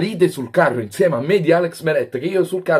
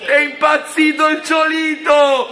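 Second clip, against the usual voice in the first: ecstatic goal shout; 13 semitones higher, much slower than usual. Real, not acted. Vlogger celebrating the penalty goal, ecstatic screaming. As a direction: erupt like your team just scored.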